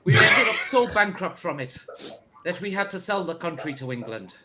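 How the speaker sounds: a lilting Scottish accent sliding into Irish, lightly voiced; mock Scottish first minister, accent wandering into Irish; Scottish accent drifting Irish. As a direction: play Sturgeon but let it slip toward Irish. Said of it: "Oh, God, that was Irish".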